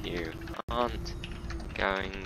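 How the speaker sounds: slow, deliberate warning to a passenger; slow stern warning. Acted. Bus driver slowly warns a fidgety passenger, stern and deliberate.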